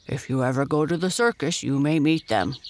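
quick, put-on bear voice; bear character. A narrator's reading. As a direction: chat briskly as a storybook bear. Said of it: "said Dido".

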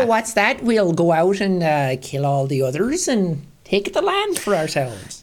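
folksy mock Midwest settler accent. Settler casually plotting a land grab, Midwest accent.

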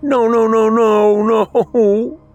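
high, wailing panic; freaking out. A narrator's reading. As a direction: let the panic spill out in a high wail. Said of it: "Bobby was freaking out".

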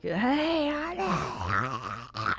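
soft, creepy whispered come-on; mock whisper. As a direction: imitate a drunk club guy whispering close. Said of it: "and just wisp".

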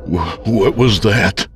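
slow, shaky frightened question; weak frightened voice. Acted. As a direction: barely get the words out in fear. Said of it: "I muttered in a weak voice".

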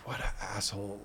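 low and very soft, to yourself; quiet self-reproach. Retold or imitated. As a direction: retell scolding yourself under your breath. Retelling self-blame thinking of kids.

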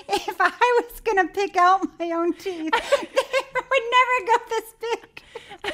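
high, laughter-filled voice; laughing hard. Real, not acted. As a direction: barely get the words out through real laughter. Helpless laughter about big teeth.

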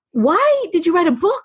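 ordinary talk, nothing marked

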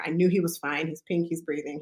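ordinary talk

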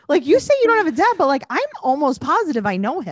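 put-on high, loud, fast child's protest; imitating a little girl. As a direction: blurt it out like an eager small child. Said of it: "she's like".

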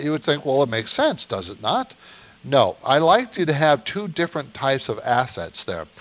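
ordinary delivery

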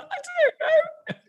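slow squeaky laughing I don't know; strained high denial. Retold or imitated. Teacher squeaks a denial to the curious pupil. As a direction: squeak out the lie.

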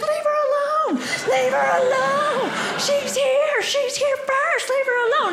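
very high, indignant crowd yell; shouting back. Retold or imitated. Crowd defends her against the truck driver, shouting back. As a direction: imitate bystanders yelling in her defence. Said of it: "they start shouting back".

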